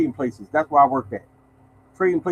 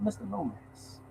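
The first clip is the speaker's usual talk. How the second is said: Dracula voice; 2 semitones higher, 17 dB quieter, slower than usual. Retold or imitated. Vice president greeting the mailman, in a hushed Dracula voice. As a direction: lean in and purr it like a count. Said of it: "He talked like Dracula".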